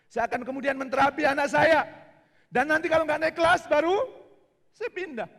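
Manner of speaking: loud, high pleading; determined father; insistent plea. Retold or imitated. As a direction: press the teacher hard.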